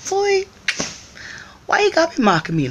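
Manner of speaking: high, fast sassy challenge; sassy call-out. Retold or imitated. Calling out the staring guy, high and fast sass. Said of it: "And I was like".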